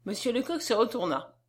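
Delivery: ordinary delivery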